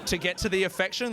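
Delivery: usual speaking voice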